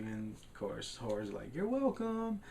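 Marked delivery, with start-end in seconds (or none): 1.5-2.4 high, silly character voice; imitated meek sidekick, squeaky-high; Horror. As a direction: pitch it way up for a sweet little reply. Retelling Horror's reply to Adventure.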